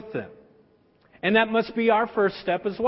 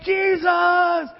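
crowd yelling; 8 semitones higher, slower than usual. Retold or imitated. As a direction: call out like a fan mobbing a rock star. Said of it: "they're yelling his name".

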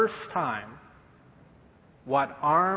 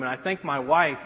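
usual speaking voice

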